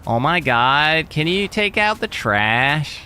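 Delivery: put-on slow drawn-out Valley Girl Oh my God; Valley Girl accent. Shown as a demonstration. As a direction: stretch it like a Californian Valley Girl. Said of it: "the Valley Girl".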